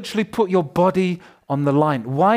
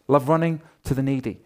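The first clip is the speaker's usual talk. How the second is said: normal talking voice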